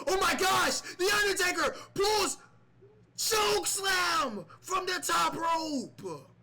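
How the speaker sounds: high, loud screaming commentator; excited shout. Pretend. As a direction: yell it like the finisher just landed. Commentator erupts at chokeslam.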